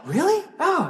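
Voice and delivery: high-pitched retold astonishment; mock surprise. Rider learns his blog is read, surprised. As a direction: imitate pleased surprise, pitch jumping up.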